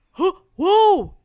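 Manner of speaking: high loud startled yelp; jumping in fright. Retold or imitated. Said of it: "I heard him go".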